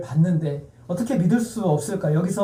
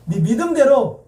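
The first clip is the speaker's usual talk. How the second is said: slow, louder, higher proclamation; lead for repetition; 6 semitones higher, 5 dB louder, slower than usual. Shown as a demonstration. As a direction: call it out for all to repeat. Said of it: "따라하겠습니다".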